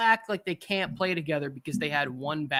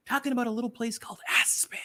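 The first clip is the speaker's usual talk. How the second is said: whisper; 7 semitones higher, faster than usual. Retold or imitated. Whispered punchline.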